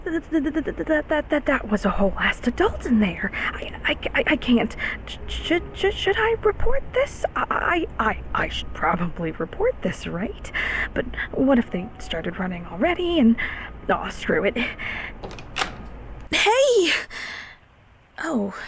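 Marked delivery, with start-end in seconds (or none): 0.0-10.5 flustered, high-pitched stuttering; rattled, stumbling babble; panicked stammer. Acted. Panics after seeing an adult. 16.3-16.9 loud, high-pitched hey; abrupt high shout; startled call. Acted. Greets the adult, startled.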